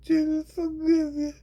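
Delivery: very high, loud squeaky blessing; mock pious falsetto. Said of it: "And they be like".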